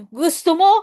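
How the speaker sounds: high, loud question to a deaf elder; calling out with gestures. Retold or imitated. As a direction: shout it clearly so she hears. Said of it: "mataas na yung boses mo".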